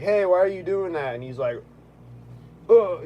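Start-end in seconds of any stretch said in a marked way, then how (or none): 0.0-1.1 higher, louder call out; raised challenge. Retold or imitated. To the man cleaning his shoe, loud challenge. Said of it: "I'm like". 2.7-3.0 loud, high irritated ugh; annoyed grunt. Retold or imitated. Pissed-off stranger, loud annoyed grunt. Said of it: "getting all pissed off".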